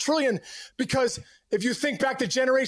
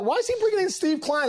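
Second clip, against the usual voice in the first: asked much higher, in mock disbelief; incredulous question; 9 semitones higher than usual. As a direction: pitch it up like a baffled hitter. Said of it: "And I'm like".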